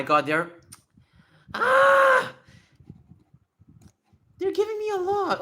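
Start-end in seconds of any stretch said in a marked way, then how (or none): 1.5-2.3 loud, very high-pitched scream; high wail of overwhelm. Real, not acted. Overwhelmed by many requests. 4.4-5.4 high, loud overwhelmed whine; real high-pitched fluster; overwhelmed squeal. Real, not acted. Overwhelmed by many requests.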